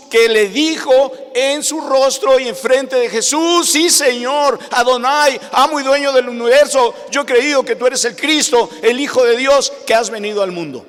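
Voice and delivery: fervent declaration of faith; impassioned preaching. Real, not acted. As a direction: proclaim it boldly to the congregation. Preaches Marta's confession forcefully.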